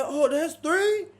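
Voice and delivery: high, loud, slow surprised question; hammed-up cellmate surprise; mock surprise. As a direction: act shocked, stretched out.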